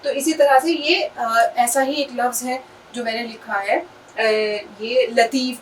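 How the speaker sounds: ordinary talk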